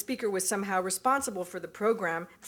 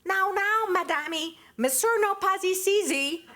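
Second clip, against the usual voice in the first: put-on much higher, louder, sugary Portuguese-accented French; mother fobbing off a rival caller in a high, sweet Portuguese accent; sweet Portuguese accent imitation; 11 semitones higher, 4 dB louder, slower than usual. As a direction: mimic the nanny sweetly to brush off the caller. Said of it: "her voice going up several octaves in an excellent imitation of Judith and Silvina's Portuguese accent".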